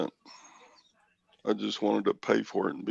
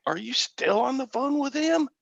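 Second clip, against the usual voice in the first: quoting Gwen; 14 semitones higher than usual. Retold or imitated. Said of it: "and she said".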